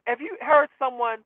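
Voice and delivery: ordinary talk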